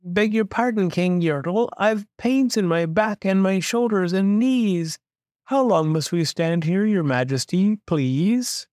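high, polite little plea; small high voice begging pardon; plain little turtle. A narrator's reading. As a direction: plead meekly upward to a king. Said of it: "this plain little turtle looked up".

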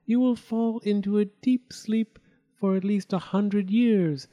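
fairy casting spell. A narrator's reading. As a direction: cast the gift solemnly. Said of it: "finished casting the spell".

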